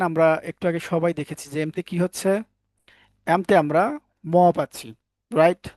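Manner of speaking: normal talking voice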